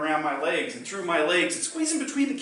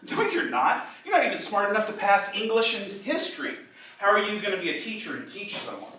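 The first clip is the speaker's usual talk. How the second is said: teacher scoffing at the student, pitched higher; scornful teacher; 6 semitones higher than usual. Retold or imitated.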